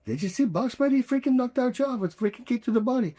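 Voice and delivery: mocking teammate. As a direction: imitate an adult raving about the kid in the locker room. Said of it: "overhear them talking about me".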